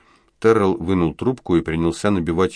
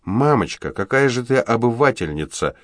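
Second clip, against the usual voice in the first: cheeky jab; 6 semitones higher than usual. A narrator's reading. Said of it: "выдала девочка".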